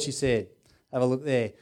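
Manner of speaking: ordinary delivery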